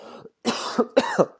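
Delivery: hard real cough; coughing fit. Real, not acted. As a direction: cough through a tight throat. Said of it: "I'm choking up".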